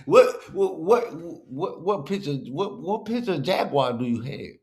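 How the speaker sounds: imitating a stuttered comeback, amused and mocking; mocking stammer. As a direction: stumble repeatedly on the first word, making fun. Mocking the clip's stammered question.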